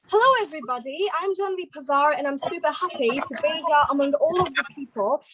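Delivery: British English greeting; British-accented hello to an audience. Shown as a demonstration. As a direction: introduce yourself in a British accent. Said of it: "starting with the British accent first".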